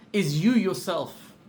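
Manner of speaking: usual speaking voice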